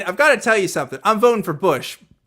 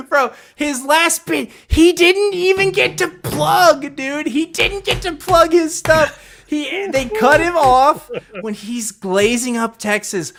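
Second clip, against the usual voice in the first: incredulous rant; 11 semitones higher, 6 dB louder than usual. Real, not acted. Incredulous rant about the guest.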